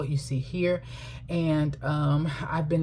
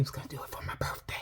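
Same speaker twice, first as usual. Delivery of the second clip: a quick, hushed confiding aside; whispered aside; 11 dB quieter, faster than usual. Real, not acted. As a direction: let the audience in on a secret, whispering. Wry aside about husband's gift.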